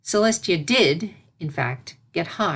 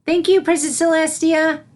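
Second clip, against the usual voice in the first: high, louder, delighted little voice; glowing with praise from her teacher; beaming filly; 11 semitones higher, 7 dB louder than usual. Acted. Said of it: "Sunset beamed".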